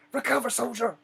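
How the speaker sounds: loud, high barked command; drill sergeant yell. Retold or imitated. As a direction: roar the order across the yard. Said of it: "When he rose his voice".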